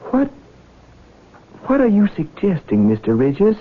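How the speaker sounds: high, alarmed demand; shocked question. Acted. Son reacts to murder hint, alarmed. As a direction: recoil from the idea.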